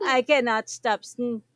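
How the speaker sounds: ordinary delivery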